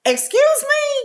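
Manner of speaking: high, slow, offended "excuse me"; indignant. Real, not acted. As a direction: act shocked and insulted. Mock offense at teasing.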